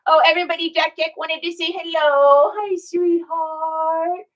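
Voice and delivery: high doting pet voice. Real, not acted. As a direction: coo at the dog. Greeting her dog on camera.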